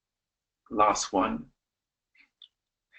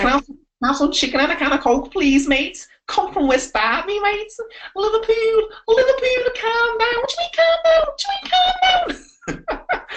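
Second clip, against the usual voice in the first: very high, squeaky hammed-up Scouse; proper go for it in cartoon Scouse; Scouse accent; 3 dB louder than usual. Shown as a demonstration. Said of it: "that's the Scouts".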